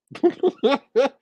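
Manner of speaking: short high mocking laugh; a quick gleeful cackle at him. Real, not acted. Vlogger laughs off her rival, high and gleeful.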